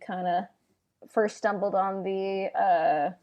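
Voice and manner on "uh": usual speaking voice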